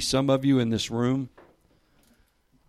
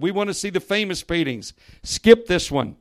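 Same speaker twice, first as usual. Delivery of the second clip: raised, impatient call from the back; a touch of an annoyed bidder yelling out; mild shout; 8 semitones higher, 9 dB louder than usual. A narrator's reading. Impatient bidder at the back calls out, mildly shouting. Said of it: "a voice in the back of the room shouted".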